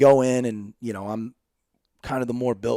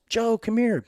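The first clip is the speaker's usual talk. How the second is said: high-pitched calling out, only slightly louder; retold yell; 11 semitones higher, 3 dB louder, slower than usual. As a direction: call Joe over in a mock yell. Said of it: "and she yells".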